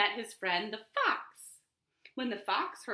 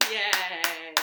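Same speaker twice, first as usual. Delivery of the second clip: yay; 3 semitones lower, 5 dB louder than usual. Real, not acted. Cheering at end of song.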